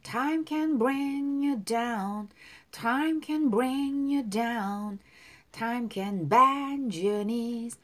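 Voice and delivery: very slow, each word drawn out, "can" held; slow pronunciation demo. Shown as a demonstration. Teacher drilling the lyric for students, very slowly. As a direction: spell the line out slowly for learners. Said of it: "발음 다시 한번".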